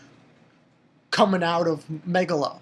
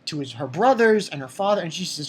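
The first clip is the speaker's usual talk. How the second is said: usual speaking voice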